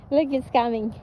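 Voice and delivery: ordinary delivery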